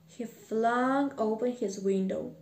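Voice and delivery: ordinary delivery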